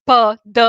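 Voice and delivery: "p, d" as puh, duh with schwa vowels; Bucharest pronunciation. Shown as a demonstration. Telling a friend what Bucharest speakers say, "p, d" as puh, duh. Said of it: "you don't pronounce p, d".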